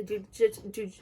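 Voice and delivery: nervous stutter on the word. Real, not acted. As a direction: stumble and repeat the first sound. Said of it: "I'm sorry for all the stuttering".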